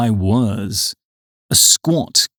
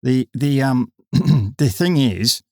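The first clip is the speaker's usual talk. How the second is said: hesitant and halting; 2 semitones higher, slower than usual. A narrator's reading. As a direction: stumble nervously over the words. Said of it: "began Ryan hesitantly".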